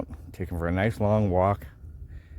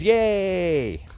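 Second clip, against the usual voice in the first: much higher excited yay; a room cheering the guest who finally sat; 12 semitones higher, 4 dB louder than usual. Retold or imitated. Room full of strangers cheering the right chair, excited yay. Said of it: "And everybody goes".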